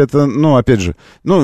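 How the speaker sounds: normal talking voice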